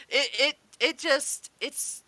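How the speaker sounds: ordinary talk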